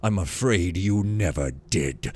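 low, measured verdict; stern divine lord. Acted. Builder condemning the rich novice, low stern voice.

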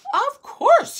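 high, loud angry outburst. A narrator's reading. Prince stung by Rosamond's question, angry outburst. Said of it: "he said angrily".

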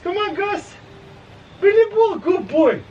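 very high, singsong pet talk; excited high-pitched dog voice; calling the dog. Real, not acted. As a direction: egg the dog on and praise it up high. Calling and praising his dog.

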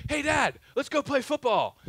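lightly voiced eager little boy; a hint of a bouncy kid asking Dad. Dad voices son Noah's request.

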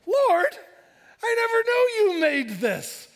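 high, loud astonished call to God; awed prayer. Retold or imitated. Pastor praying in the aquarium, awestruck. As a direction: cry out to God in wonder. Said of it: "I was looking at things going".

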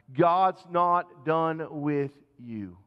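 very slow, each word weighed, "God's" stressed; slow emphatic assurance. Real, not acted. Preacher assuring the congregation, very slow and weighty. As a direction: press it into their hearts slowly.

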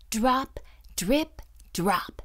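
ordinary delivery

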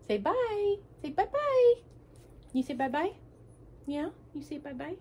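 high coaxing bye-bye voice; sweet high pet talk. Real, not acted. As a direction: coo goodbye to the dog.

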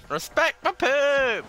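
high, loud, slow shriek; shrieked catchphrase. Retold or imitated. Imitating the pink attacker charging in, high shriek. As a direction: screech it out, dragging each word. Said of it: "someone comes in like this".

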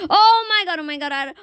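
panicked yelp; scared horror game streamer. Real, not acted. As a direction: yelp in fright. Zombies chasing him.

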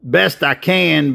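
usual speaking voice

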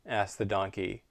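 ordinary delivery

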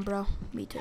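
normal talking voice

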